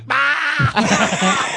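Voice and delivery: high-pitched open "ah" cry; imitated high outburst. Storyteller acting out friends blowing their cover, high outburst. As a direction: blurt a high cartoonish cry. Acts out their burst of laughter.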